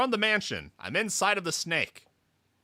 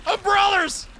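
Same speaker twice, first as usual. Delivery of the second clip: alarmed cry; 7 dB louder than usual. Acted. Spirits swarm, alarm call.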